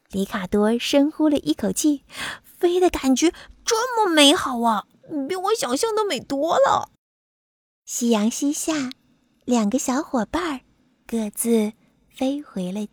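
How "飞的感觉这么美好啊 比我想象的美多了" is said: high, thrilled butterfly voice; delighted wonder. Acted. Butterfly revels in his first flight, delighted. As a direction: marvel happily, pitched high.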